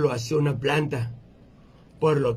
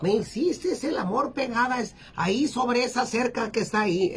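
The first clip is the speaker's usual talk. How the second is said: put-on higher-pitched old granny reminiscing; abuelita; 6 semitones higher than usual. Grandma reminding her husband of their early days in Tijuana, high old-lady voice. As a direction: play a little old lady recalling old times. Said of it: "le dice".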